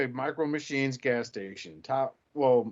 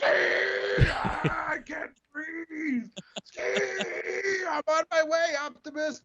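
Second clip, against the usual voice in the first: much higher, half-crying shout; strained crying cartoon voice; much slower than usual. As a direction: squeal it out like a panicked little robot. Hot Hose voiced in a fan story.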